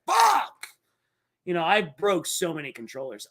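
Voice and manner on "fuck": imitating rage at a game; mock gamer yell. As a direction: blurt it like a game just killed you. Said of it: "You're like".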